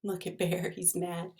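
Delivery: plain reading voice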